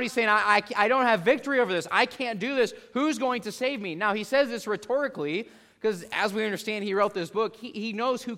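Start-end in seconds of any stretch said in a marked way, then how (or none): 0.3-3.9 loud, high anguished cry for help. Acted. Paul crying out to God, a loud high desperate plea. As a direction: plead out loud, desperate and pitched up. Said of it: "He's crying out".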